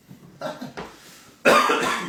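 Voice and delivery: loud burst of laughter. Real, not acted. Speaker laughs at a water-spilling mishap, real laughter.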